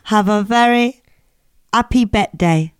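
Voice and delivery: phrased exaggerated Nigerian accent; mock Nigerian accent. Shown as a demonstration. Host demonstrating accent hack, mock Nigerian accent. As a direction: put on a Nigerian accent, pausing mid-phrase. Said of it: "this is the Nigerian".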